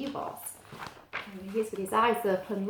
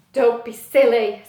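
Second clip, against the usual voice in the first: louder, plain-pitched penguin voice; penguin Pete; 9 dB louder than usual. Acted. Pete telling Steve he can't fly, louder. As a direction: brush it off like a sturdy penguin.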